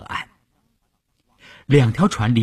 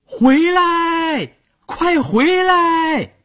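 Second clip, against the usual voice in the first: high, drawn-out urgent call; shouting to a boat; much slower than usual. A narrator's reading. Shaoyue in the water to the departing boat, high shout. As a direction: call them back from far off, stretching the words. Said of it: "朝着快到河中央的船喊".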